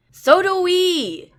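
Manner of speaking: loud, high, slow shout back; yelling back. A narrator's reading. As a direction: bellow it down the hallway. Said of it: "Sai yells back".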